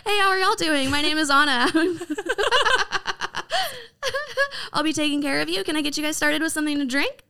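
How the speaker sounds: high chirpy server welcoming guests; customer service voice. Shown as a demonstration. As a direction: sell the table on your cheeriness. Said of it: "say it in your customer service voice".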